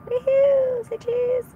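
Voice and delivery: very high playful squeal; excited whoop. Real, not acted. Driver whooping at the photo radar camera, playful.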